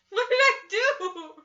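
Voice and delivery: high, loud baffled outcry; loud, high panicked protest; shocked shout. Retold or imitated. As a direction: yell it in disbelief. Her shocked reaction retold.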